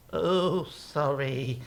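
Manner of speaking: small, soft mock apology; quiet, acted-out contrition; sheepish apology. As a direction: apologize meekly, caught out. Balaam sees angel, apologizes.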